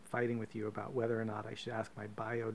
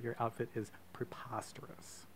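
whispering; 8 dB quieter than usual. Real, not acted. Snide aside about the outfit.

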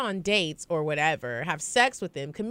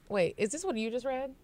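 ordinary talk

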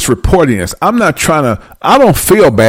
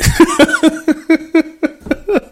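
high laughing fit; 13 semitones higher than usual. Real, not acted. Laughing fit after the punchline.

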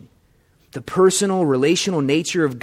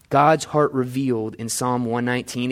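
ordinary delivery

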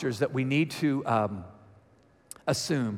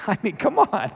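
laughing; 7 semitones higher, 4 dB louder than usual. Real, not acted.

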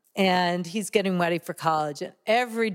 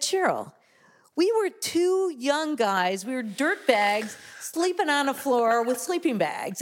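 quoting a friend; 6 semitones higher than usual. Retold or imitated. Quoting Adam's reply.

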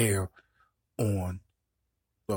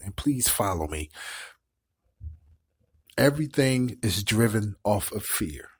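normal talking voice

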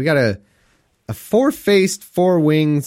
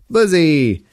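playful exclamation; 4 semitones higher, 5 dB louder than usual. Pretend. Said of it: "sounds like a frat boy's nickname".

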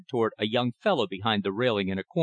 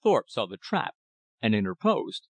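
ordinary delivery, nothing marked

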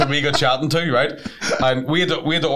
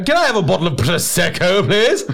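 higher-pitched, affected polite order; put-on theatre snob ordering a drink; pretend arts lover; 5 semitones higher, 3 dB louder than usual. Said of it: "you enjoy the arts and the theatre".

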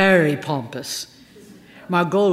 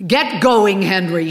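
loud, higher order to the driver; commanding; 4 semitones higher, 8 dB louder than usual. A narrator's reading. Star ordering her driver Henry, loud and higher. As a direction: bark it at the chauffeur. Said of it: "she commanded".

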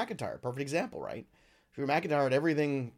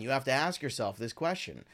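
ordinary talk

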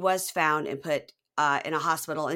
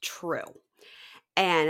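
ordinary talk, nothing marked

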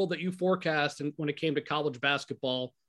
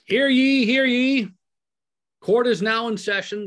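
booming court crier, higher and louder; grand rich voice; 7 semitones higher, 7 dB louder than usual. Acted. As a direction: call it out like a courtroom bailiff. Court crier opens the session.

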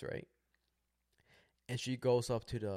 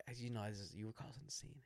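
quiet retold scolding; 9 dB quieter than usual. Man telling his girl she caused a scene, softly reproachful. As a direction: keep it down, measured and serious.

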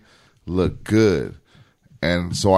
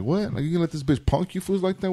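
high, soft incredulous questioning; retold disbelief; 7 semitones higher, 6 dB quieter than usual. Boss hears his guys got punked, mock incredulous.

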